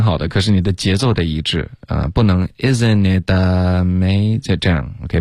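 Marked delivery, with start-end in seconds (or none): 2.6-4.6 "isn't it" quick, "amazing" dragged out; slowing down at end. Retold or imitated. Teacher copies uneven rhythm, dragging "amazing". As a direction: let the phrase sag slower on "amazing". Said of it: "不能后面变慢了".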